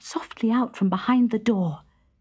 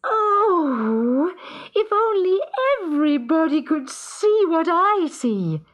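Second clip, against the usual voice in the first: a bright, pitched-up cry of delight; high happy singsong; 8 semitones higher than usual. Acted. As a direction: sing it out high and clear. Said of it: "sang out in a high, clear, happy voice".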